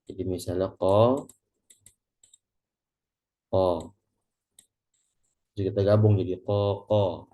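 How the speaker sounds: ko held over four beats; four-beat demo. Shown as a demonstration. Teacher demonstrating a four-beat vowel. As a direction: stretch ko long. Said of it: "Maka itu kadar empat".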